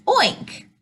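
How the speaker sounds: high, playful oink; high-pitched storybook pig sound. A narrator's reading. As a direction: imitate a pig for small children. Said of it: "The pigs say".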